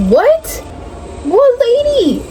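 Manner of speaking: high, confused asking back; puzzled what. Retold or imitated. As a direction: ask it baffled and high. Quoting puzzled question in dream.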